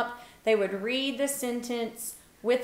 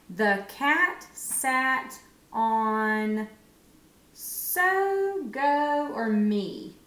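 very slow reading, sung-up choices; slow sounding out; much slower than usual. Shown as a demonstration. Teacher models reading to her class, slow and lilting. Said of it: "[name]'s gonna try".

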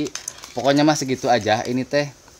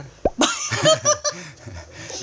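shrill burst of laughter; high-pitched laugh. Real, not acted. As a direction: squeal with laughter. Laughing after joke about paying.

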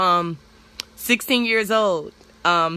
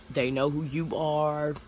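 ordinary delivery

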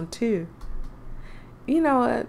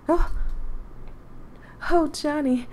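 Kat startled; 7 semitones higher than usual. Acted. Voicing Kat's startled line.

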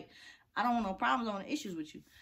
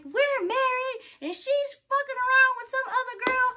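high, whiny mock of the ex; 5 dB louder than usual. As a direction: mimic the ex in a squeaky voice, much higher. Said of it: "She's like".

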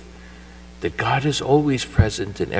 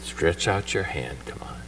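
gentle whisper; 3 semitones lower, 9 dB quieter than usual. Acted. Jesus reaching for sinking Peter, whispering gently. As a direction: coax him quietly to safety.

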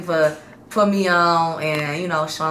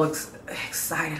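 breathy whisper on excited; 2 semitones lower, 9 dB quieter than usual. Retold or imitated.

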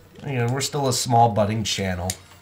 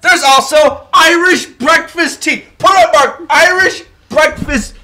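loud, high triumphant yell; triumphant shout; 8 dB louder than usual. Real, not acted. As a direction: shout it out, proud to be right. Shouting to win tea argument.

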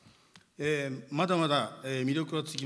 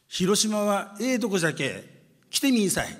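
raised, lively Hiroshima dialect invitation; 5 semitones higher, 5 dB louder than usual. Shown as a demonstration. The mayor invites the audience to Hiroshima in Hiroshima dialect, higher and lively. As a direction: say it like a hometown Hiroshima local, pitched up. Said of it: "ちょっと広島弁で広島のアピール".

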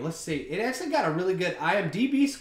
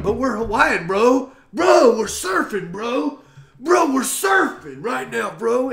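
higher, louder, slow surfer-bro voice; hammed-up insistent bro talk; mock Hawaiian bros; 6 semitones higher, 8 dB louder, slower than usual. Guy mocking Hawaiians who pester him, loud surfer-bro voice. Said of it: "And they're like".

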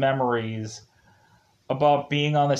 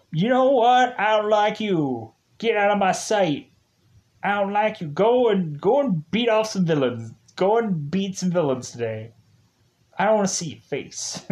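mock grumpy Kruger; 6 semitones higher than usual. Kruger snapping at his Rangers, mock angry and higher. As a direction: shoo them away in a mock huff.

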